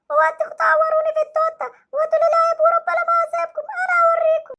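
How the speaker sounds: put-on hurt boy threatening the doctor with his dad; sulky hurt child. Same child answering teacher.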